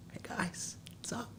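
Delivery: breathless whisper, casual; a whispered mock hello to the crowd; whispered greeting. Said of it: "he should have been like".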